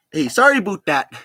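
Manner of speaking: put-on "about" said as "aboot" in a Canadian accent. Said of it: "Sounds Canadian".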